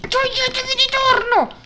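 high-pitched cry to clear off; exasperated high shout; irritated yell. Real, not acted. Yelling at game mobs.

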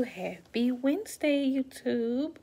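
excited greeting. Real, not acted. Energetic vlog greeting.

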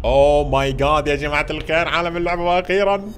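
loud, high, thrilled cry; excited shout. Real, not acted. Gamer to viewers on reaching the world, thrilled shout. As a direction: burst out in delight.

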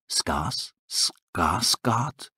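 guttural wolf cries. Acted. Dying wolves calling out, low guttural moan. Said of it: "ein paar kehlige Schreie".

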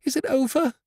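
high-pitched, anxious question; excited cry. A narrator's reading. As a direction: cry it out high, desperate to know. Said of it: "cried Dot".

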